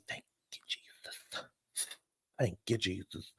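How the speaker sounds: whispered, unvoiced praise; hushed grateful whisper to Jesus; whispered thanks. Real, not acted. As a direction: breathe it out in relief. Whispered relief.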